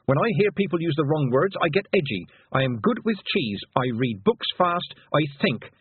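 mildly angry, exultant assertion; joyful anger. A narrator's reading. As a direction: proudly insist on who you are. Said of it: "The anger rose up joyfully".

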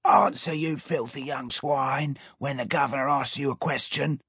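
high, fast, barked order; guard screeching at the boy to answer the governor, high and fast. A narrator's reading. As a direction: screech it at the silent prisoner. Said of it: "so the Chief Chasso creeched".

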